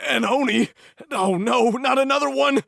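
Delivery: high, startled cry of fear; scared merchant yelping; frightened. Acted. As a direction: recoil in panic at the sight. Masato frightened by an Oni.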